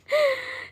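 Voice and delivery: high breathy giggle; a real squeaky giggle. Real, not acted. Showing her plant, a real high giggle. Laughing at herself over plant.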